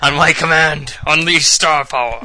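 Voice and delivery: loud, high dramatic battle command; epic commander voice. Pretend. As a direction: bellow it like a game hero. Mock game command voice.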